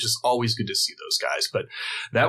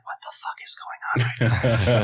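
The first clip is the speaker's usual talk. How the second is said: whispered disbelief; stunned whisper. Retold or imitated. Meeting the band, whispered stunned disbelief. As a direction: hiss it under your breath, amazed.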